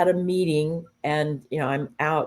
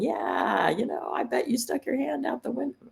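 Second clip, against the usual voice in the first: high, softer mock-teasing voice; mock teasing remark; 8 semitones higher, 4 dB quieter than usual. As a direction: tease lightly, lifting high and soft. Voicing an inappropriate joking remark.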